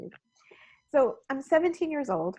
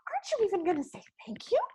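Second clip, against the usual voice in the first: put-on higher, exasperated child's demand; a lightly voiced annoyed schoolgirl; annoyed friend; 9 semitones higher than usual. A grade three friend demanding thanks, exasperated. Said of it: "she looks at me and she says".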